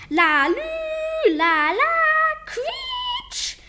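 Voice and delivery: high wailing siren sound; sung-out siren noise ending in a squeal; siren imitation. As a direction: wail like a police siren.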